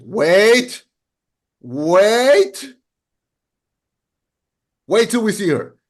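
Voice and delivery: drawn-out, rising double take; mock collective disbelief. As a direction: stretch each WAIT upward, pausing between. Acting out a collective doubtful reaction.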